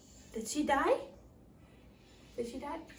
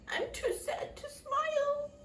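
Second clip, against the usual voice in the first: sad; 11 semitones higher than usual. Acted. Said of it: "says the happy dwarf".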